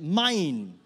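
loud, hammed-up toddler "Mine"; exaggerated possessive cry. Shown as a demonstration. Preacher leads congregation in toddler's word, exaggerated and possessive. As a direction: lean hard on it like a possessive kid. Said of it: "A bit more accent".

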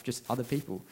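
ordinary talk

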